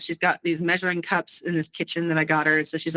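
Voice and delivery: normal talking voice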